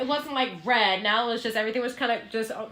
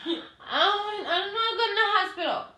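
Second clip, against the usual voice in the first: high, mock-tearful protest; high-pitched acted pleading; whiny refusal; 8 semitones higher than usual. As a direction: whine it like a woozy patient. Acting out drugged refusal of hospital.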